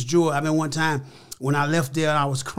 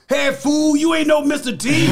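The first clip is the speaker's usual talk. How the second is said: loud, much higher mock holler, laughing through it; fans hollering; 11 semitones higher, 6 dB louder than usual. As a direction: bellow it like a teasing fan across the street. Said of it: "my fans would holler".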